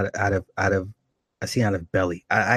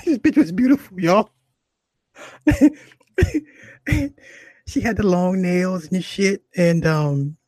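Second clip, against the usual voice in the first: high, loud words squeezed through laughter; cracking up; 9 semitones higher, 4 dB louder than usual. Real, not acted. Comic describing beautiful ex to viewers, cracking up. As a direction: insist while helpless with laughter.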